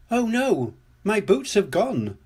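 put-on high, lightly upset child figure; mild storybook dismay, pitched high; sobbing. A narrator's reading. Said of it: "sobbed Peppa".

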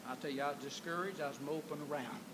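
ordinary talk

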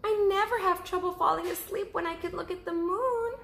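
high, small cub voice; little cub asking. Acted. Cub asking mother about sleeping outside, high little voice. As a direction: ask like a curious little bear cub. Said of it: "explained Baby Bear".

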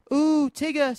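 high, thrilled little squeal. A narrator's reading. Roo rides up the tree on Tigger, high excited squeal. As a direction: ooh it out high, like a delighted child. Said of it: "squealed Roo".

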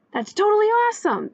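high, thrilled exclamation; excited shout. A narrator's reading. Little boy seeing animals drive by, shouting. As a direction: pitch it way up in delight. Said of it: "Edison shouted".

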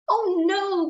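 high, drawn-out storybook "oh no"; puffling's high wail of alarm; dismayed cry. Acted. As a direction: cry out in acted dismay, pitch up then falling. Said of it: "cried the puffling".

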